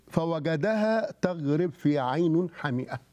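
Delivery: measured, drawn-out scripture quote; quoted verse, slowly intoned; slow recitation. Acted. Quotes scripture after asking what it says.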